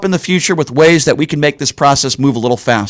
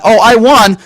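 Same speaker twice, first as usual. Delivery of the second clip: higher, louder mock boast; mocking winner; 7 semitones higher, 5 dB louder than usual. Host mimics Laxalt claiming victory, mocking and loud. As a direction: imitate a candidate crowing over a win. Mocks a winning candidate's imagined claim.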